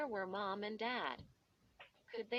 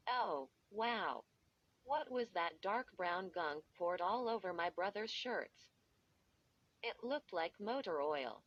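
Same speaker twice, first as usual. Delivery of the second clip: plain reading voice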